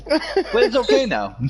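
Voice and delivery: mock soothing. Mock reassurance after bad things.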